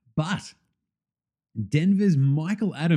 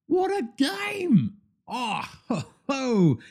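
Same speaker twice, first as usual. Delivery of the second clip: much higher, slow, drawn-out cry; amazed cry; 10 semitones higher, much slower than usual. Real, not acted. Podcast host marvelling at a 1991 scoring game, crying out. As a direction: stretch it out like you can't believe it.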